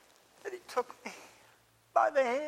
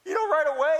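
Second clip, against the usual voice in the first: ordinary delivery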